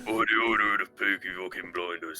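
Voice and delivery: hammed-up Brummie impression; mock the thick Birmingham accent. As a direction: put on a broad Peaky Blinders Brummie. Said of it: "the way they speak".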